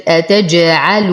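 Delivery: slow أتجعل, bounced jim and full final u; qalqala, light lam. Shown as a demonstration. Teacher reciting for students, أتجعل with full damma. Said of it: "نتم ضمة اللام مع الترقيق".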